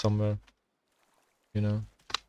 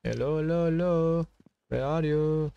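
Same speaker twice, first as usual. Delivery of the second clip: calling out; 7 semitones higher than usual. Pretend. Player calling to enemies he cannot find, calling out. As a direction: call out in a higher singsong, stretching the endings.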